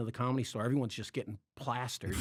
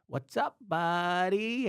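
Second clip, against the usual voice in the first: high, slow, drawn-out buddy greeting; Pauly Shore impression; 7 semitones higher, 4 dB louder, much slower than usual. Comic imitating his new boss's greeting, high and slow. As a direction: stretch the greeting out like the famous comic. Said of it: "that guy talks like that".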